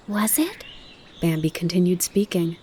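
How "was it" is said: put-on curious young fawn. A narrator's reading. Bambi asks.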